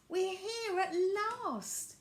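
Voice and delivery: joyful. A narrator's reading. Violet's joy at arriving.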